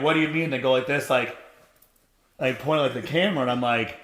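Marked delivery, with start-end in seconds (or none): 0.0-0.5 fake surprise. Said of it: "I don't talk like this to people".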